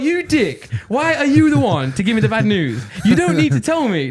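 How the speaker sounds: high-pitched retold outrage; indignant. His silent reply to the train passenger, comically indignant. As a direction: rant it as a comic furious thought. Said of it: "made me really mad".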